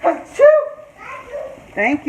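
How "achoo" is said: loud sudden sneeze; sharp high achoo. Real, not acted. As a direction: let a real sneeze burst out. Sneezes on camera while cooking.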